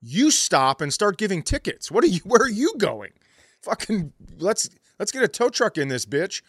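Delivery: exaggerated ranting at the cops; mock yell at campus cops. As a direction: yell at the security cops in a hammed-up rage. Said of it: "I'm like".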